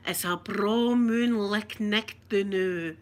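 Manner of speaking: slow, drawn-out broad Scots phrase. Shown as a demonstration. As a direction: lay on the Scots slowly. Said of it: "a saying that everybody thinks us Scottish says".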